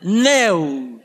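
long, drawn-out Australian "no"; Australian accent. Shown as a demonstration. Preacher jokes with congregation about saying no, Australian accent. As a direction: stretch the vowel like an Aussie. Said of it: "Should I say it with an Australian accent".

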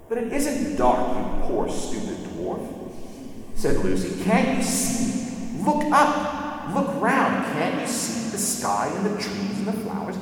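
put-on high, slow, imploring girl's voice; pleading Lucy. A narrator's reading. Lucy pleading with the blind dwarves, high imploring cry. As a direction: beg them to look, rising in pitch.

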